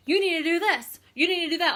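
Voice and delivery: bossy salesperson. Retold or imitated. Team leader mocking pushy sellers, bossy and loud. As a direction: point the finger with each You. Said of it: "We are always like".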